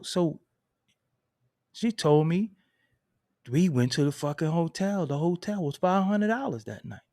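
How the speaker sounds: ordinary talk, nothing marked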